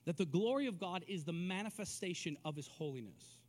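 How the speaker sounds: ordinary talk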